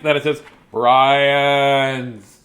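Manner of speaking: drawn-out punchline; slow punchline. Acted. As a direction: stretch it slowly.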